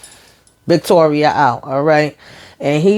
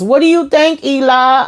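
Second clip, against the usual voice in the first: sarcastic taunt; 9 semitones higher than usual. Real, not acted. Mocking the character on screen.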